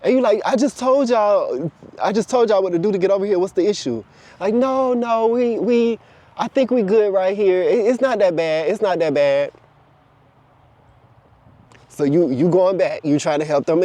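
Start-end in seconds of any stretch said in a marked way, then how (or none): 0.5-4.0 higher-pitched, frustrated repeating; mock-impatient complaint; acted exasperation. Acted frustration with those refusing. 4.5-9.5 high start, easing down; lightly voiced balking; reluctant excuse-making. Voicing the reluctant ones' excuses.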